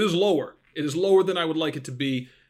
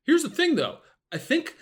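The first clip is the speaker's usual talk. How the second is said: high excited defence; 14 semitones higher than usual. Real, not acted. Defending his bad betting run.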